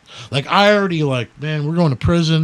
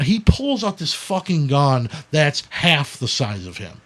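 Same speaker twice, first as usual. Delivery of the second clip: ordinary talk, nothing marked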